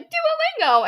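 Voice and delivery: very high, loud imitation of a clueless friend; high-pitched mock voice. Girl retelling friend's blurt to an eighth grader, high-pitched mockery. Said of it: "my friend was like".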